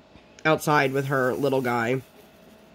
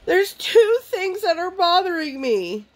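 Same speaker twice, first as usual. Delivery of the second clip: put-on high, slow whimpering little-girl voice; weepy toddler. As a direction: mimic her teary toddler voice. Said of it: "weeping at this point".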